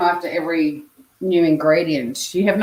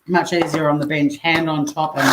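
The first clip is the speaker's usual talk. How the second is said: usual speaking voice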